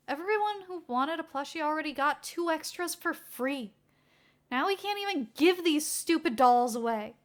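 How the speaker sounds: venting about the boss's failed plushies; griping sidekick. Acted.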